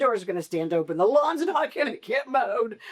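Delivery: high, frantic what-if voice; mock panicked worry. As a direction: spiral into a comic panic about everything falling apart. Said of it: "I think all the time".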